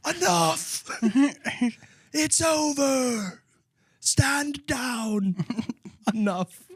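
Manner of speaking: high, slow hammed-up battle cry; Mel Gibson yell. Imitating a movie war hero, hammed-up yelling. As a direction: play a war hero calling a halt. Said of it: "like Mel Gibson would yell in The Patriot".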